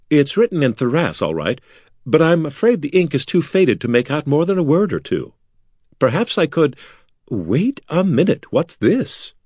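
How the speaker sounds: eager nobleman. Acted. Voices eager Corin in the story.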